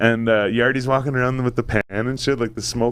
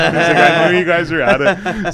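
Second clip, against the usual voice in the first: laughing while quoting; 6 semitones higher, 6 dB louder, slower than usual. Retold or imitated. Neighbour quoted, laughing.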